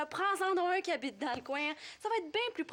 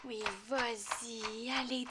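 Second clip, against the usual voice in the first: very slow coaxing; 4 semitones lower, much slower than usual. Acted. Waiting for her to cry.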